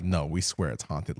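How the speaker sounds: low, soft imitation of staff; hushed insistence. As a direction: act out a hushed warning. Acting the staff's grave warning.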